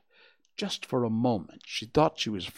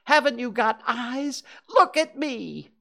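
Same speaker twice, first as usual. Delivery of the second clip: high, loud exasperated demand; shouting; 14 semitones higher, 6 dB louder than usual. A narrator's reading. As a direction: plead loudly to be recognised. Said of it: "shouted Piglet".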